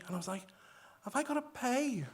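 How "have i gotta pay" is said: high, anxious pay question; higher, laughing worry; mock worried question. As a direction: fret about the bill. New migrant fears bill.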